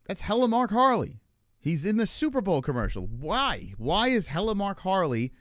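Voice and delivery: high, incredulous exclamations; astonished. Retold or imitated. Spotting a guest in a Super Bowl commercial, astonished.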